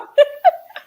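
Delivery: a light, high giggle; embarrassed laugh about the small show. Real, not acted.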